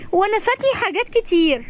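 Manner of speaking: put-on high-pitched little kid voice; high, childish complaint; small child. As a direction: pipe up like a young child.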